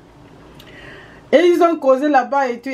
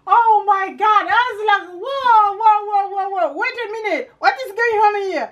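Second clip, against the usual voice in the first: higher, amazed wow wow wow; higher, stretched disbelief; mock astonishment; 9 semitones higher than usual. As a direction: play up the shock of hearing it. Amazed reaction in English.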